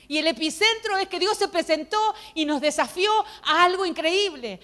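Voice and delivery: emphatic preaching. Real, not acted. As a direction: proclaim it to the congregation. Preaches the point of her testimony.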